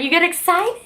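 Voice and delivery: high excited coo at the dog; high-pitched pet voice. Real, not acted.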